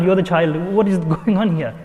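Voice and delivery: usual speaking voice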